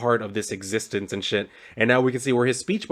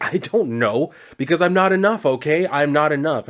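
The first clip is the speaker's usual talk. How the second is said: retold frustrated outburst, higher and louder; exasperated outburst; 6 semitones higher, 5 dB louder than usual. Marshall admits to the alien why he's here, exasperated. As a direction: act out exasperated self-doubt, pitch jumping up.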